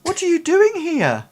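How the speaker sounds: put-on very high-pitched astonished old man; old man's astonishment. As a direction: squeak it out as a bewildered old father. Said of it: "he's sort of like".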